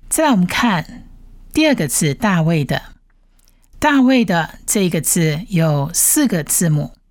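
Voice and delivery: ordinary talk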